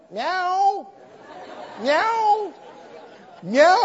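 high, plaintive mock meows; cat meowing. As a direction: mew like a begging stray cat. Cat back the next morning.

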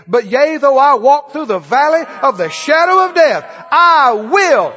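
loud, ringing scripture proclamation; defiant declaration. Acted. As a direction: proclaim it forcefully over fear. Defiant scripture declaration answering fear.

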